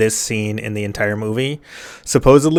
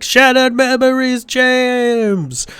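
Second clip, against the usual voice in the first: high, drawn-out mock wail of a voice; mock eerie call; 13 semitones higher, much slower than usual. Narrator mimics the doctor's phone call, high mock wail. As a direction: wail it high, stretching out the name. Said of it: "that's like".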